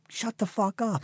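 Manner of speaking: high-pitched mock telling-off; rude retort. Man mouthing off to old people, mock rudeness.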